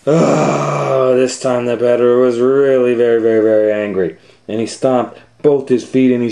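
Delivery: loud, high, worked-up telling; heated narration. A narrator's reading. Narrator builds the peddler's rage, loud and heated. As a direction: tell it as if the anger is catching.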